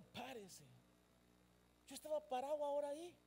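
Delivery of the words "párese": soft, dropped, matter-of-fact delivery; quiet compliance advice. Acted. Preacher advising a member to just stand, soft. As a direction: let it fall away as simple obedience. Advice to simply obey the order.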